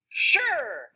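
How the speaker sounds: high, loud, excited burst. Shown as a demonstration. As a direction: say it with eager enthusiasm. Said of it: "like she's excited about it?".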